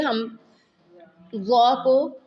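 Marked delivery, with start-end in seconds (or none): none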